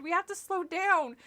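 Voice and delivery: desperate shout. Acted. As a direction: keep screaming at them. Continues the screamed plea.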